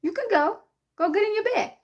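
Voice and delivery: high coaxing voice to the dog; high sing-song to a pet; high pet talk. Real, not acted. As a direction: shoo the dog to bed sweetly. Talking to the dog.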